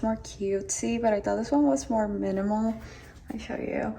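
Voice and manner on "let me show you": soft unvoiced whisper. Real, not acted. Whispering to viewers while showing the shelf. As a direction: breathe it under your breath.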